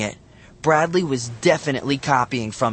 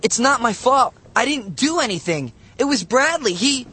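put-on high, frantic boyish pleading; panicked protest; 12 semitones higher, 3 dB louder than usual. A narrator's reading. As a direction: plead your innocence in panic. Boy protests being blamed.

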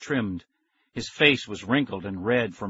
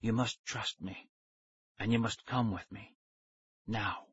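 thin whisper; 9 dB quieter than usual. A narrator's reading. Stranger urging the kids to follow, thin whisper. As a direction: urge them quietly in a thin voice. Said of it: "He had a thin whisper of a voice".

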